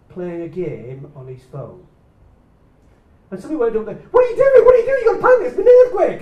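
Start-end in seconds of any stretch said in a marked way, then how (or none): 4.1-6.2 high, loud frantic yelling; panicked shout. Retold or imitated. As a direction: shout it in a frenzy at the calm son. Someone urging son to panic.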